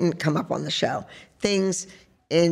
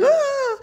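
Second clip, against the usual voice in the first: sudden squeaky "Ah" of alarm, much higher; startled high yelp. Retold or imitated. Mock alarmed yelp as she recalls parents reacting.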